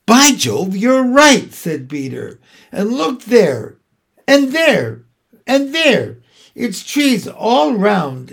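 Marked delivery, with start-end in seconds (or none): 0.0-1.5 loud, high burst of discovery; amazed exclamation. A narrator's reading. Peter agrees with Susan about the light, amazed and excited. 2.7-8.3 higher, slow and emphatic wonder; excited discovery. A narrator's reading. Peter shows the others the trees all round, excited wonder.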